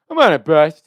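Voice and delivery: high, very loud character blurt; hammed-up loud announcement; cartoon prank voice. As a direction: call it out as a cartoon prank caller. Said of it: "Jimmy character from South Park voice".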